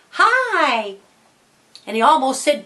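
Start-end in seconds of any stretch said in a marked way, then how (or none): 0.1-1.0 put-on high, drawn-out girly greeting; Sally. Disguised boy greeting the contest staff, falsetto girly voice. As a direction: play a boy faking a girl's hello. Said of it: "and he said".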